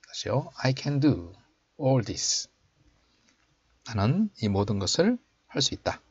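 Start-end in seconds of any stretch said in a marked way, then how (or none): 0.6-2.5 slow, raised teaching voice; slow model reading. Shown as a demonstration. Teacher showing learners the English verse, slow model reading. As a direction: read it out slowly for learners to copy.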